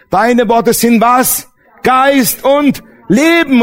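slow preaching emphasis; slow emphatic. Acted. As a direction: stretch every word of the answer. Preacher drawing the answer slowly.

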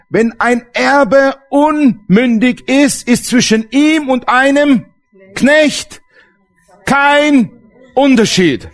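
preaching the verse to the church; slow declaiming. Acted. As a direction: proclaim it word by word. Preacher declaiming scripture slowly.

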